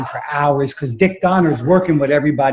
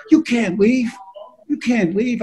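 high-pitched pleading imitation; imploring; 8 semitones higher than usual. Buddy stopping friend walking out of audition, imploring. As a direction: beg in a raised voice, twice over. Buddy begs him not to leave.

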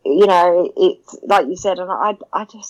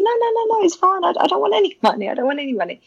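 much higher, quick insistent no; higher, hurried protest; flustered refusal; 11 semitones higher, much faster than usual. Retold or imitated. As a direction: wave the offer off, pitched way up. Retells refusing money.

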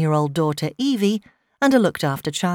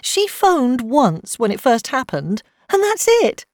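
higher, louder, aggrieved; upset complaint; 7 semitones higher, 4 dB louder than usual. A narrator's reading. Mother complaining about her social worker, upset. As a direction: protest it, distressed.

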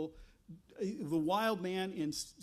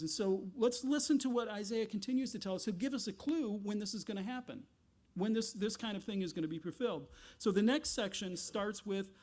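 ordinary talk, nothing marked

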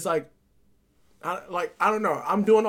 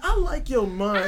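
slow, laughing, louder; emphatic laughing repeat; 3 semitones higher, 5 dB louder, slower than usual. Real, not acted. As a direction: lean into it with a laugh. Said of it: "repeats it with emphasis".

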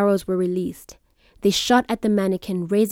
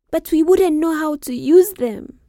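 put-on higher worried girl; 8 semitones higher, 3 dB louder than usual.

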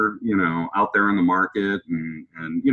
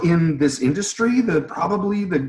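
high, amazed inner voice; acted-out astonishment; starstruck disbelief; 10 semitones higher than usual. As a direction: marvel at it, pitched way up. Stunned at idol's invitation, acted.

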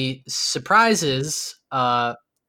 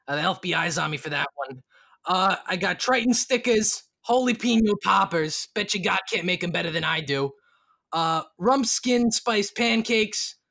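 hawking barkeep; 4 semitones higher than usual. Acted. Barkeep listing the menu to the party, hawking. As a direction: rattle off the menu like a proud cook.

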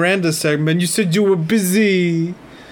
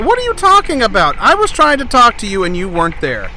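shouting; 6 semitones higher, 4 dB louder than usual. Acted. Host shouting at Ollie.

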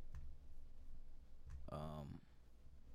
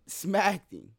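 higher and louder gloating; excited, louder victory punchline; triumphant; 8 semitones higher, 6 dB louder than usual. Real, not acted. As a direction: crow about the win. Triumphant gaming story payoff.

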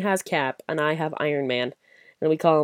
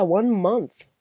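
normal talking voice

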